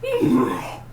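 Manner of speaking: high squeaked hum, then airy "oh"; wordless comic noises of a bumbling onlooker; Mister Bean. Retold or imitated. Mister Bean reacting in the room, comic impression. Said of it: "if Mister Bean was in the room".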